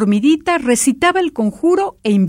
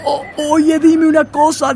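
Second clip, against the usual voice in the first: higher, nervous stammer; stammering, frightened; 7 semitones higher than usual. A narrator's reading. Frightened king stammering while clinging on. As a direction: stutter out the question in fright. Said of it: "tartamudeó asustado el rey".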